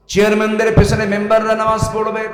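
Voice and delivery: loud, high-pitched oratory; impassioned preaching. Real, not acted. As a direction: drive the list home to the crowd. Preacher escalating a rhetorical list. (উচ্চকণ্ঠে জোরালো ওয়াজ)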